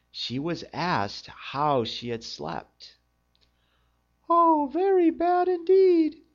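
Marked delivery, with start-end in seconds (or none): 4.3-6.2 very high, loud cartoon princess; a squeaky, complaining princess. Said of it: "she replied".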